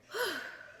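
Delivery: a long sigh of relief; exhaling relief after a hard night; high relieved sigh. Retold or imitated. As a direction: let the breath out high. Morning relief.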